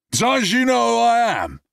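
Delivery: high, loud slurred threat; drunken bravado. Acted. Drunk man threatens Brad, loud high slurring. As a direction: bark it drunkenly.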